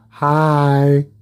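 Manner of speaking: a hint of a spooky animal saying hi. Animals in the boonies greeting the driver, low creepy voice. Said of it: "That's the animals talking to me".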